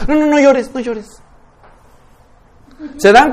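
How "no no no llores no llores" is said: placating parent. Acted. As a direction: hurriedly hush a crying child as a servile parent. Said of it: "¿Qué hace el súbdito?".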